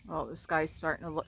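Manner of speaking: usual speaking voice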